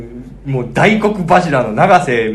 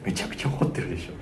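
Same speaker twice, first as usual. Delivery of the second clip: quick mock whisper; rushed near-whisper, insisting; hushed insistence; 6 semitones lower, 13 dB quieter, faster than usual. Girl insisting to colleague the caller is furious, whispered. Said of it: "先生はそう言いました".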